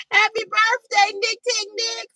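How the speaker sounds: high, loud celebratory shout-out; excited birthday cheer. Real, not acted. Host wishes Nick happy birthday, cheering.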